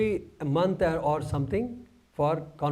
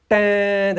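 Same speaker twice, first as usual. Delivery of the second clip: loud held trumpet-like "tan"; imitated first note; 4 semitones higher, 8 dB louder than usual. Bandsman demonstrating the first note the band played, imitated loud note. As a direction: sing out a blaring note. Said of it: "that sound, you know".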